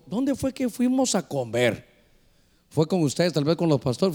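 normal talking voice

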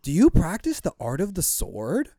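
high, loud amazed stoner bro; impressed stranger. Retold or imitated. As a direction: gasp it out in awe of the moves. The guy impressed by moves.

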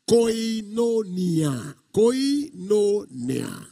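"koinonia" stretched out syllable by syllable; slow, deliberate sounding-out of "koinonia"; slow Greek term. Shown as a demonstration. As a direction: teach "koinonia" very slowly, every vowel held. Teaching the Greek word slowly.

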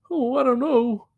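put-on high, loud weird-boy voice; Kenneth. Imitating a typecast sitcom character, high loud voice. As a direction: pitch it way up as a naive Southern oddball. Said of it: "like, Kenneth. I couldn't see him playing".

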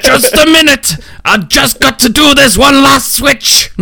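very high, squeaky put-on voice; high-pitched character mimicry, drawn out; mimicked character voice. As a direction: mimic a character in a falsetto, stretching out the last words. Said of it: "that I can mimic".

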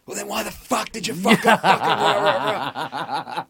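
high, loud, laughing outburst; mock fury over blah blah blah; mock angry yell. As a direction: snap at him, half laughing. Acted angry punchline.

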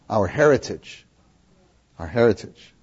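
a touch of a proud elder, repeated; lightly voiced father stressing heritage; father counseling son. Father reminding Noah of the family heritage, mildly solemn. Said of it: "If I were he, I would talk like this".

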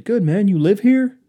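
loud, high-pitched door-to-door hello; mock salesman greeting. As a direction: call it out like a pushy caller. Salesman greeting him in retold story.

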